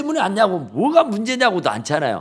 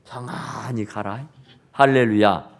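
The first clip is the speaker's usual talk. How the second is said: usual speaking voice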